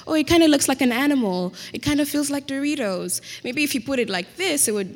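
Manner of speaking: high, lightly voiced nosy stranger picking at her hair; a light send-up of people judging her hair; mock nosy outsiders. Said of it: "like, describe it as".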